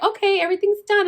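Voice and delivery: high, fast nurse announcement. Retold or imitated. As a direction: chirp it like the nurse. Nurse announces delivery done.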